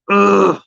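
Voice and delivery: a loud, fed-up ugh of disgust; disgusted groan. Real, not acted. She's disgusted by what the girl did, loud groan. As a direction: let out a sharp groan of real disgust.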